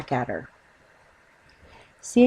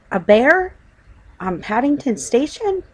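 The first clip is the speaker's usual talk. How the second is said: astonished; 7 semitones higher, 5 dB louder than usual. Acted. Husband spots a bear.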